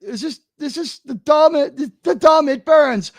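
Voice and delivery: high, anguished complaint; pained exasperation. Real, not acted. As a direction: whine it high as if it hurts. Mock agony at the promo.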